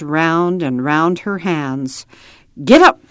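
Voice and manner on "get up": loud, higher command to a team; urging horses on. A narrator's reading. Papa urges the horses forward, loud driving call. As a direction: call out sharply to get the horses moving. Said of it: "Papa clucked".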